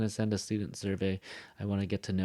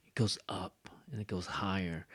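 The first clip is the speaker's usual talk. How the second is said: whispered aside; 5 dB quieter than usual. Real, not acted. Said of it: "aside whispered".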